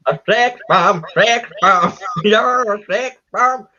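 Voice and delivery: botched impression landing in West Country; accidental West Country accent. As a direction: belt the song in a West Country burr. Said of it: "It's like West Country".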